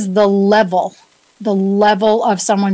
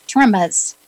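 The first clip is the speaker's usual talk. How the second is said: New England accent; much slower than usual. Shown as a demonstration. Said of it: "That's my New England accent".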